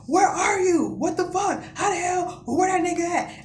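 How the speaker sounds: high, urgent questioning; worked-up, high-pitched interrogation; agitated brother. Retold or imitated. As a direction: imitate a brother firing questions down the phone. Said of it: "a million miles a minute".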